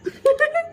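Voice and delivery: a short rising giggle; high giggle. Acted. Lanza still amused by the robot cat, a high giggle. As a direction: giggle again, high and light. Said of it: "Lanja हंसते हुए कहता है".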